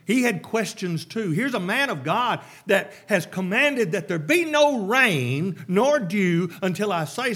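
impassioned preacher; preaching loudly. Real, not acted. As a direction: drive the point home to the church. Preacher builds to a point.

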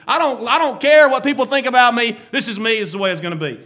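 lightly voiced stubborn Jonah; a hint of digging in; defiant Jonah. Jonah insisting to the Lord, mildly defiant. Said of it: "he's talking to the Lord".

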